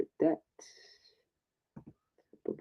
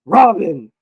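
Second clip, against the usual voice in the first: Robin! shouted firm with a falling end; right call; 6 semitones higher, 15 dB louder than usual. Pretend. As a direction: call Robin! loud and sure, dropping pitch on the second syllable. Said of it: "Yep, that's good".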